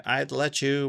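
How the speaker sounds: ordinary talk, nothing marked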